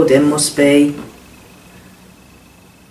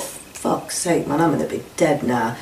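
breathless unvoiced whisper, much softer; terrified whispered thought; 7 dB quieter than usual. Hostage whispering his thought to himself in terror. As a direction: whisper the panic to yourself. Thinking while held at knifepoint.

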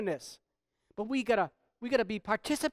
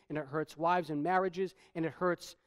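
ordinary talk

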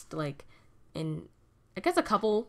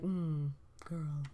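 low sassy murmur; 5 semitones lower, 9 dB quieter than usual. Retold or imitated. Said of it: "He's just like".

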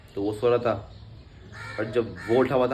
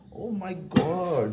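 excited gasp; 7 semitones higher, much slower than usual. Real, not acted. Excited food reveal.

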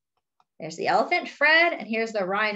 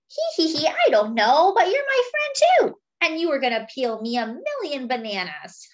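lightly voiced sly monkey, a bit higher; cheeky monkey; 7 semitones higher, 3 dB louder than usual. Monkey teasing his elephant friend, lightly voiced and sly. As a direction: coax him like a sneaky little monkey.

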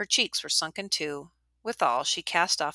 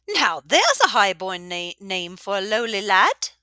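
high, flirty tavern-keeper voice; teasing old widow; 4 semitones higher, 8 dB louder than usual. A narrator's reading. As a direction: tease him playfully.

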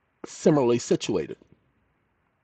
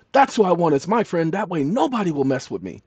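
raised pitch, acted childish enthusiasm; eager child; 5 semitones higher than usual. Child telling father about wanting Jehovah as friend, eagerly. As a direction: declare it like a delighted small kid.

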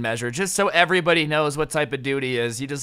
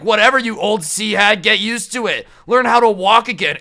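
raised, loud imitation of a rude guy; mock mad; 4 semitones higher, 5 dB louder than usual. Manager quoting the guy mad at old ladies, mock mad. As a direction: jeer it in mock anger. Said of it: "You're getting mad at old ladies".

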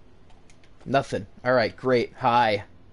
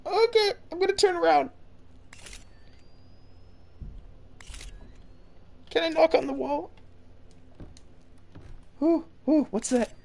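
high, fast nervous voice; scared horror game streamer; 12 semitones higher, 4 dB quieter, faster than usual. Real, not acted. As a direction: talk fast and high, creeping along.

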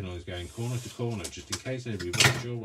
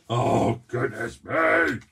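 breaking character giggling; 2 semitones higher, 10 dB louder, much slower than usual. Real, not acted. Glue won't flow, corpsing.

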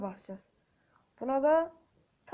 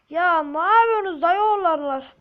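louder, near-crying complaint; frustrated whine; 3 semitones higher, 8 dB louder than usual. Real, not acted. Gamer whining loudly at his teammates, near tears. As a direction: wail at the teammates in despair.

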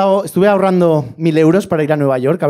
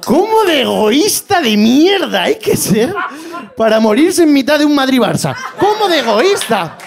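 mock outrage; 8 semitones higher, slower than usual. Outraged retort to father.

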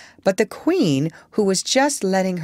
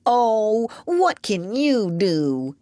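slow, drawn-out tearful complaint; put-on voice on the edge of crying; tearful whining; 3 semitones higher, much slower than usual. A narrator's reading. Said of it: "beginning to cry again".

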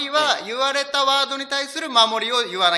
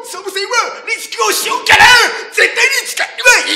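put-on voice; 12 semitones higher, 7 dB louder than usual. Risk of [name] impression.

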